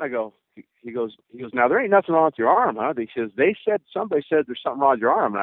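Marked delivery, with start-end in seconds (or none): 1.5-5.3 coach's voice. Retold or imitated. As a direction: voice the old coach, pitched up. Coach's voice in retold scene.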